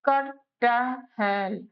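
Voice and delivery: कटहल stretched out very slowly, क-ट-हल syllable by syllable; slow syllable demo. Shown as a demonstration. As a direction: draw कटहल out slowly for a learner. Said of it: "अब देखो कटहल". (कटहल को बहुत धीरे, एक-एक अक्षर करके)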